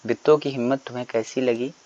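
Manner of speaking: normal talking voice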